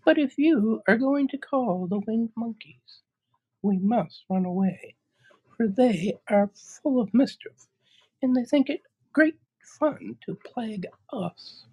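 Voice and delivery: very high, tiny mouse squeak; squeaky little voice. Acted. Queen of the Mice offering help, high squeak.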